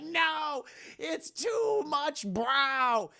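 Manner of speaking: high, drawn-out cry of protest; mock horror. [name] sees his huge forehead, mock horrified wail. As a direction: wail it slowly in hammed-up horror.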